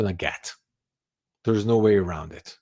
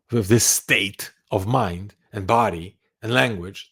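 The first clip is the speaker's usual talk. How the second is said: ordinary delivery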